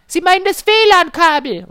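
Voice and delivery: high, loud correcting customer; know-it-all customer. Retold or imitated. Customer correcting the technician, high mock voice. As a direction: lecture him in a high mock voice.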